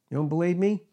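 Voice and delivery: ordinary talk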